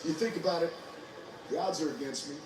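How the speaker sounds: ordinary talk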